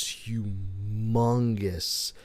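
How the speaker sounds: HUMONGOUS drawn out, stress on the middle syllable; emphatic humongous. Real, not acted. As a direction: stretch "humongous" slowly to make it huge. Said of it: "I am emphasizing humongous".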